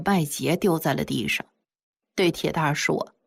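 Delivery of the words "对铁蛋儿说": ordinary delivery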